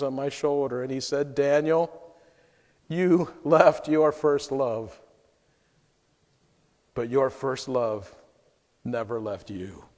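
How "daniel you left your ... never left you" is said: tender. Retold or imitated. Jesus speaks tenderly in vision.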